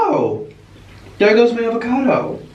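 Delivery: sudden high gasp and cry; startled gasp. Real, not acted. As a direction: yelp as the avocado falls. Avocado falls.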